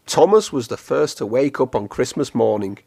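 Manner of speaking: plain reading voice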